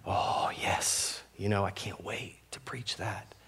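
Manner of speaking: hushed, savoring delight; quietly retold excitement; eager anticipation. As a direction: imitate eager relish in a soft, airy murmur. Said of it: "I'll get excited and eager".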